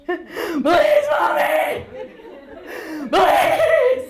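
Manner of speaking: put-on high, loud, pleading kid wail; begging little boy. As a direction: beg through tears like a five-year-old. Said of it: "He's like".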